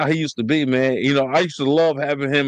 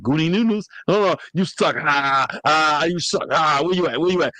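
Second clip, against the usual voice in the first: playful imitated heckle, pitched up; mock taunt; 3 semitones higher than usual. Terrell heckling siblings over a video game, mock taunting. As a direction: tease like a rival over a game. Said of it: "He'd be like".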